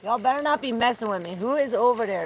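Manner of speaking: quick, loud, high challenge; warning call. Real, not acted. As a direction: challenge an unseen intruder loudly and fast. Warns whoever is nearby.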